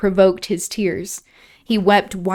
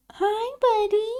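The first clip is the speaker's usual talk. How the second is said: sweet high pet voice; 3 dB quieter, slower than usual. Real, not acted. Reader pausing to greet her cat, high baby-talk coo.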